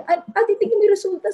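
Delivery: imitating a friend near tears; retold crying. Friend showing her results, imitating her tearful plea. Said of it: "Na umiiyak siya".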